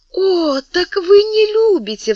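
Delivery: high, loud anguished exclamation; cry out. Acted. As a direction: fling the accusation out high and loud. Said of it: "вскричала Мария Манчини". (вскрикнуть высоко и громко)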